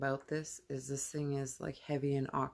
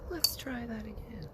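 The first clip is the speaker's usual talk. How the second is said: light whisper, a bit higher; 6 semitones higher than usual. Real, not acted. Hanging a crystal outside at night, whispering. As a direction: breathe it as you retry.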